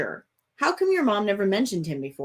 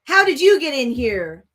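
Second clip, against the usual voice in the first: loud startled call; 6 semitones higher, 8 dB louder than usual. A narrator's reading. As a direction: call it out sharply from across the room. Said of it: "a voice calls".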